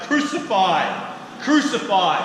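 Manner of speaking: loud, slow, drawn-out shouts of crucify; crowd shouting. Acted. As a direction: bellow it like a baying mob. Chief priests shout for crucifixion.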